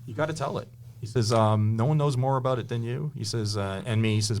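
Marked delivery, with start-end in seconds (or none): 0.0-0.7 hint of old radio voice. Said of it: "nineteen twenties, nineteen thirties kinda talk". 1.8-3.1 hint of old radio voice. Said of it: "nineteen twenties, nineteen thirties kinda talk".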